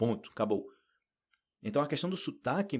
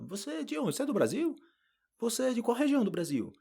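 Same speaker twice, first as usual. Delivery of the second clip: high, curious voice for the people quizzing him; curious questioning; 11 semitones higher than usual. Retold or imitated. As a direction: play the nosy relatives asking where he's from. (pessoas curiosas perguntando, voz aguda)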